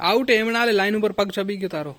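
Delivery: playful loud referee call. Real, not acted. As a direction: call the foul across the yard. Calling out in a game.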